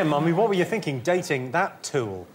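usual speaking voice

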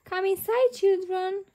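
mildly acted call from a parent. A narrator's reading. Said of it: "calls Daddy Pig".

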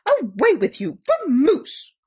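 forceful dismissal, rising to loud; exasperated Bear. A narrator's reading. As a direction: chase the intruder off. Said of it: "rumbled Bear".

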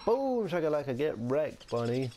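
loud, high victory cry; playful gloating shout; triumphant crowing. Real, not acted. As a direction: crow over your kill, easing down by the end. Crowing over killing a bunny.

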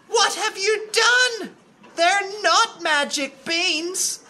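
high, loud outraged mother; sharp mum crying out. Acted. Mother cries out at Jack over beans, sharp and high. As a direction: shout it sharply, very emotional. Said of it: "his mother cried".

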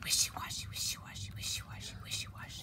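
soft rhythmic whisper; hushed swishing sound; whispered rustle. A narrator's reading. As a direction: imitate grass swishing as something slithers through. Imitates grass moving as Snake comes.

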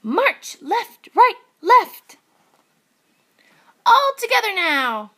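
high, loud commanding piglet; high piglet calling out marching orders; band leader. Acted. Little Pig leads band.

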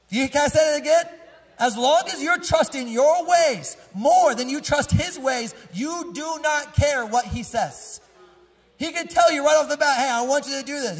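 high, loud preaching voice that builds to a cry; full-force preaching. Acted. Preacher to the congregation, pressing the point at full force. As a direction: lift the room and drive the point home, loud and high.

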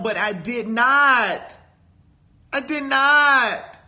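drawn-out NOT, hit hard; emphatic denial. Real, not acted. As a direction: stretch "not" slowly and land it with force. Emphatic self-correction, repeated.

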